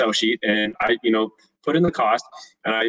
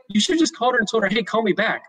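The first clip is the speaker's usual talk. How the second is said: much higher, fast lecturing wife voice; 10 semitones higher than usual. Retold or imitated. Wife lecturing husband on how to fire, higher and fast. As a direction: rattle off what he should have done.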